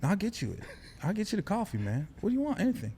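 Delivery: earnest Kevin Hart. Retold or imitated. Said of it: "like, genuine and serious".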